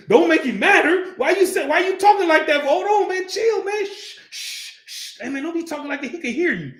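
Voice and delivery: high, urgent mock panic, dropping to a shush; scared brother hushing. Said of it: "'Cause he was scared".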